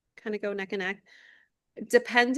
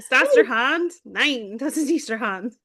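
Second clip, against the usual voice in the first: louder mock-angry German drill; angry-sounding German; 3 semitones higher, 7 dB louder than usual. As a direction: sound cross in German, playfully. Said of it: "if you sound angry".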